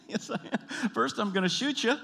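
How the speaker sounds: high-pitched, squeezed pretend menace; comic high-voiced spouse making threats; mock threat. Acted couple's threats in car.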